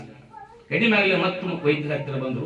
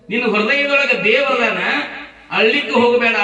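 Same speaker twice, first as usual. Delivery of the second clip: raised, high preacher's voice for the mother; impassioned reassurance; 9 semitones higher, 8 dB louder than usual. Acted. Mother tells her child God is in his heart, impassioned. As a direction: urge it loud and high.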